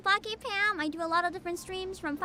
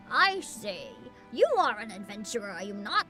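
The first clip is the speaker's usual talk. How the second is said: put-on old man voice; 6 semitones lower than usual. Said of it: "You love my old voice".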